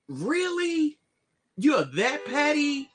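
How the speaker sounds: high, slow disbelief; can't believe how petty; incredulous. Real, not acted. Incredulous reaction.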